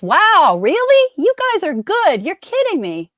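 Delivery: high-pitched, delighted disbelief; real surprise, voice leaping up; amazed. Real, not acted. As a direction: react as if you can't believe they got it. Amazed that players knew the answer.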